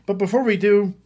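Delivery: high, loud grand showman voice; grandiose flea circus showman. Acted. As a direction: sell it like a ringmaster. Hosting, introducing his buddy Jeff.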